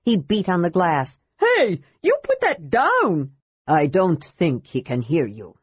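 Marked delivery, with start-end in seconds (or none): 1.4-3.3 high, slow scolding yell; indignant kender. A narrator's reading. Tass shouting at Armin through the ruby glass, indignant. 3.7-5.6 lightly voiced old dwarf. A narrator's reading. Ghost dwarf voice.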